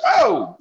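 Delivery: high shouted oh, imitating a movie character; imitating a shocked character in a show; mock shout. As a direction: yelp it like the guy in the show. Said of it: "the motherfucker like".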